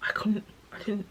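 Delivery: breaking into a whisper through real grief; real tearful whisper. Real, not acted. Telling viewers about her sister's death, in a tearful whisper. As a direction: let the words go breathless with real tears.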